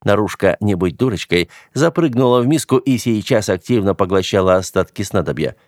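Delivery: usual speaking voice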